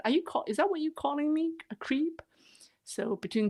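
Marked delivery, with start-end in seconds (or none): 0.0-2.2 higher, quick teasing question; higher, fast joking voice. Retold or imitated. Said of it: "He said".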